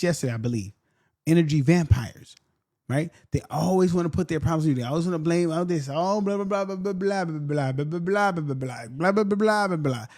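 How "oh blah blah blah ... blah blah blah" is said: mocking complaining voice. Man mocking a complaining woman's endless talk, a light whiny drone. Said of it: "That's how it sounds".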